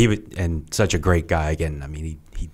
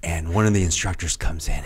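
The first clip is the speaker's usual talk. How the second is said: ordinary talk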